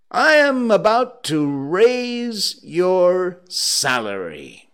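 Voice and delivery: loud, high, drawn-out proclamation; triumphant announcement. Acted. Scrooge surprises his clerk with a raise, triumphant. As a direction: proclaim it slowly, word by word.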